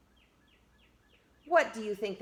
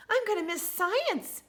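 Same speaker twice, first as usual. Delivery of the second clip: put-on squeaky little girl, pitched way up; very high child voice; 13 semitones higher than usual. A narrator's reading. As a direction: play Emily as a tiny wistful girl. Child voiced in read-aloud.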